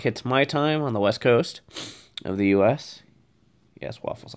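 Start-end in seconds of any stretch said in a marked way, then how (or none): 3.8-4.3 soft whisper to a pet. Real, not acted. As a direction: whisper to the dog while petting. Softly talking to petted dog.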